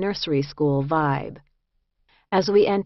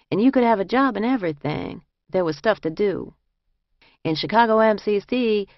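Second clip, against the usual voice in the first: lightly voiced fellow inmate, pitched up a little; quoted fellow prisoner; 4 semitones higher than usual. Fellow inmate describing the Chicago jail to the others, lightly voiced character.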